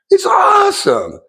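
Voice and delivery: high, slow, cracking cheer; a squeal of admiration; excited. Real, not acted. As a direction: gush with real delight. Gushing over Mister Beast's giving.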